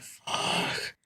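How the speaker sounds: soft exasperated exhale on the word; weary, voiceless mock curse; mock exasperation. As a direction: imitate someone who is so over it. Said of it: "He's so over it".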